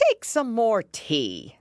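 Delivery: slow, loud, high offer; very earnest. A narrator's reading. March Hare offering Alice tea, very earnest. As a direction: press the offer with great seriousness. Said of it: "said to Alice very earnestly".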